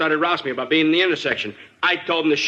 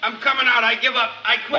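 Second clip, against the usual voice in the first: calling out; 8 semitones higher than usual. Acted. Suspect surrendering from room.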